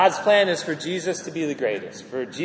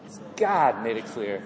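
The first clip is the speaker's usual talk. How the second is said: ordinary delivery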